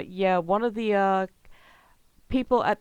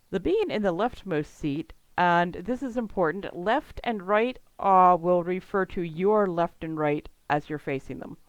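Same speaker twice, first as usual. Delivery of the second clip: ordinary talk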